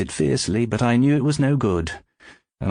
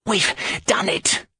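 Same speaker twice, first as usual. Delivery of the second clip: much higher, breathless exclamation; gasped triumph; 11 semitones higher than usual. Acted. George in the getaway car, gasping triumphantly. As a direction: gasp out the win. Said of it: "George gasped".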